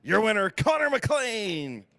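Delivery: slow, drawn-out announcer call; big ring-announcer flourish; winner announcement. Real, not acted. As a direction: present the champion to the crowd, stretching the name. Announcer presents the race winner.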